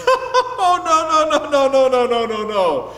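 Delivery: big laughing string of refusals; hearty dismissive chuckle; scoffing whale. Acted. Whale scoffs at rabbit's boast.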